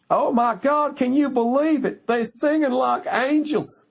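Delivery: mocking high falsetto, gushing and dreamy; gushing Broadway fan. Mocking an enraptured audience member, high gushing falsetto. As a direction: ham up a starstruck theatre fan swooning over the singers. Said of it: "the looks on their faces".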